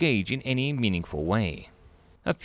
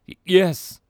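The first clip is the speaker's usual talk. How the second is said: stammered nervous yes; 9 semitones higher, 7 dB louder than usual. Acted. Nervous alien answers stammering.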